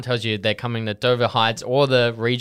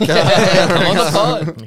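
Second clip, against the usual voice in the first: laughing; 6 semitones higher, 8 dB louder, much slower than usual. Real, not acted. Host laughing while inviting.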